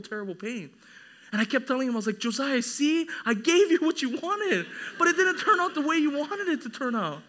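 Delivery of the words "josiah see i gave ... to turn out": high, loud retold parental lecture; admonishing father. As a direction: drive the lesson home to a child. Father admonishing crying son, retold.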